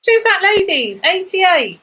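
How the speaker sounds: sing-song number calling, rising then falling; bingo caller. Acted. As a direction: call each number out to the hall.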